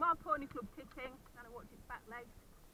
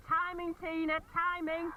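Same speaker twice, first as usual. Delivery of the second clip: shouted instruction, much louder; calling out; 10 dB louder than usual. Real, not acted. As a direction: call it across the arena. Coach calling across arena.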